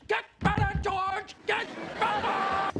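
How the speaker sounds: high, drawn-out parting yell; high, stretched-out shout; shouted send-off. Acted. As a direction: holler it after him as he's hauled away. Boss calls after employee taken away.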